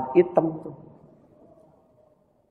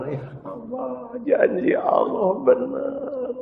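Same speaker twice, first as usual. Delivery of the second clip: much higher and softer, slow; high soft prayer; 5 dB quieter, slower than usual. Acted. Father prays softly while hugging his son. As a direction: whisper thanks to God, slow.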